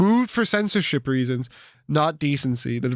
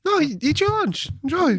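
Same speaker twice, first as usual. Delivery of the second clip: passive-aggressive; 12 semitones higher than usual. Real, not acted. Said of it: "I don't want you to talk with your mouth full".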